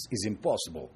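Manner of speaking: low, soft mock Russian accent. Chicken answering the eagle, in a mock Russian accent. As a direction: dismiss it in a quiet put-on Russian accent. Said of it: "That's my Russian accent for you".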